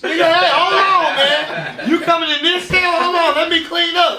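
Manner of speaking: high, loud laughing words, playing scared; laughing, panicked mock pleading. Telling friends about his huge cellmate, high laughing mock panic. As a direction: laugh through it like you're backing off from a giant.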